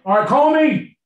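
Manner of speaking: eager high-pitched hail to the elders; calling out high. Retold or imitated. Mock missionary calling out, high.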